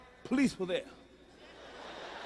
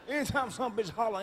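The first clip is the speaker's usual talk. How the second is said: ordinary talk, nothing marked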